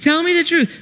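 demanding father. Retold or imitated. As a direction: act out a dad pressing for a confession. Father demands confession while spanking.

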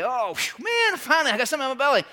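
high, loud relieved exclamation; relieved sigh. Retold or imitated. Starving man relieved after eating, high and loud. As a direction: blurt it out like finally fed.

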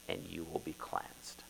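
whispered prophecy; whispered promise. Acted. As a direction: whisper it, hushed and unvoiced.

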